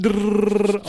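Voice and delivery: loud rolled "drrrr" gun noise; imitated machine-gun fire. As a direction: make the rattle of an assault rifle. Imitates assault rifle fire.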